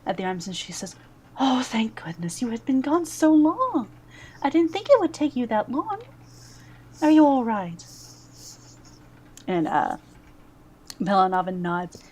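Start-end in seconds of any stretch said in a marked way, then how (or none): 1.4-2.4 breathy whispered relief, falling; relieved whisper. Acted. Mother Superior whispers relief to the returning Pelanavan. 2.4-7.8 higher, anxious motherly voice, rising to louder at the end; worried Mother Superior. Acted. Mother Superior frets over Pelanavan being gone so long, higher and anxious.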